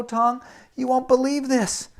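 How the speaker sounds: usual speaking voice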